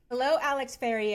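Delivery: usual speaking voice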